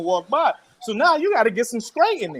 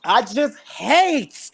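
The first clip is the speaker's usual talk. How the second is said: very slow, drawn-out, pitched-up "hate"; from the diaphragm; 4 semitones higher, much slower than usual. Retold or imitated. August confronting Tory, imitated slowly from the diaphragm. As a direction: imitate a man heaving it up from the diaphragm. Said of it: "he brought it from the diaphragm".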